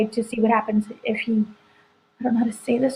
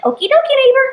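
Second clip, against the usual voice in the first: very high, louder sing-out quote; chirpy neighbor impression; 13 semitones higher, 6 dB louder, faster than usual. Host retelling Agnes's send-off before she drives off, mock-cheery neighbor. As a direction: imitate an over-friendly neighbor, pitched way up. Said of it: "And she's like".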